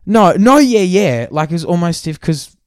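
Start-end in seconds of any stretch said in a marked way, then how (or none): 0.1-1.3 high, loud brush-off; talking right over him; old gym bloke cutting him off loudly; loud mock dismissal. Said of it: "he goes".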